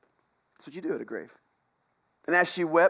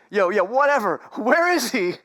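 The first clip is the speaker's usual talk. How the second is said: louder, higher, impatient brush-off; dismissive brush-off; 5 semitones higher, 6 dB louder than usual. Retold or imitated. Mary brushing off angels, loud and impatient. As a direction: wave off the angels, demand where he is. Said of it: "she's not even fazed by that".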